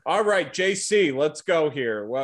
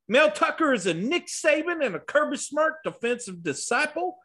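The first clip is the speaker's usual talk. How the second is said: mock Southern accent, pitched up; Bobcat impression; 8 semitones higher than usual. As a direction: ham up a Southern good-old-boy accent. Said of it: "I did the Bobcat impression".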